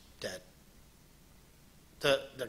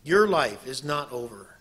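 ordinary talk

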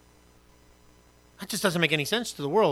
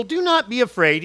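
higher, louder call not to fear; reassuring pastor; 6 semitones higher, 6 dB louder than usual. Acted. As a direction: urge them not to fear. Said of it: "A true pastor here".

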